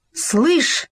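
sudden idea. A narrator's reading. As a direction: blurt it out as the idea hits. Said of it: "вдруг осенило его".

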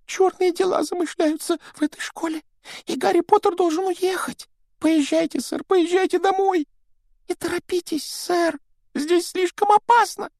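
high, squeaky pleading elf; house-elf voice. Acted. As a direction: play Dobby the anxious house-elf. Dobby warns Harry. (тонкий голос домового эльфа)

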